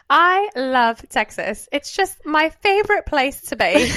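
slow, drawn-out Texas drawl; stretched-out mock Texan accent; put-on Texas accent. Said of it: "I'm gonna start talking with an accent".

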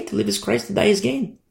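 a light, carefree take on the verse; lifted, untroubled reply. Retold or imitated. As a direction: toss it off easily, up high. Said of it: "it's like, okay".

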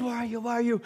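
hushed whisper, not a shout; mock whisper. Shown as a demonstration. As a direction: whisper it as God did not. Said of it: "God wasn't whispering".

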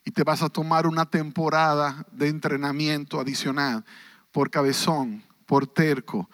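a hushed, intimate voice of God; God speaking softly. Acted. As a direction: speak as God, low and close to the listener's ear. (Dios hablando en voz baja e íntima)

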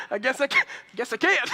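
high, loud retold kid's reply through laughter; laughing mock concession. Son answering dad on Christmas, mock concession through laughter. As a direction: laugh through an imitated childish giving-in.

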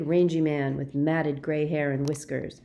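plain reading voice

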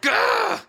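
sudden high yelp of shock; startled shout. Real, not acted. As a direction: recoil with a loud gah. Host recoils from old bag.

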